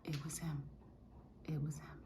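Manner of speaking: soft whispered admission. Real, not acted. Admitting to viewers it was God, whispered. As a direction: barely breathe it out. Hushed confession.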